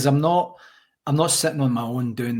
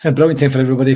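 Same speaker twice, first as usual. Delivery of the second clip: louder, punching "brilliant"; emphatic repeat; 8 dB louder, much faster than usual. Shown as a demonstration. A fan proudly repeating the championship line, loud and emphatic. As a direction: hit "brilliant" hard and proud. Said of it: "Well, I guess it was probably the autumn".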